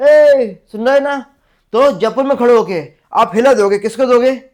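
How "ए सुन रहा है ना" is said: high, loud, slow long-distance call. Shown as a demonstration. Teacher showing how people call out a hela. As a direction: shout it out to someone far off. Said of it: "ऐसे बोलते ना".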